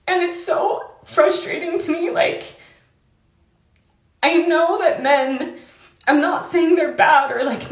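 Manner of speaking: tearful, voice pitched high and breaking; fighting back real tears; choked-up, frustrated crying. Real, not acted. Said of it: "I'm sorry, I'm crying".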